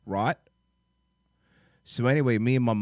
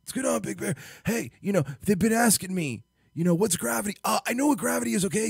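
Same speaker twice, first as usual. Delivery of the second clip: high, out-of-breath Big Bear voice; pitched-up wheezy Big Bear rambling; Big Bear impression; 7 semitones higher than usual. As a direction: puff through it like you're out of air. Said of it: "You have to sound like you're running out of breath".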